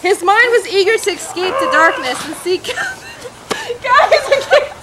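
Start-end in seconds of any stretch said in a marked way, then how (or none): 2.6-4.8 high, loud laughing words; can't stop laughing; giggling. Real, not acted. Giggling fit in costume.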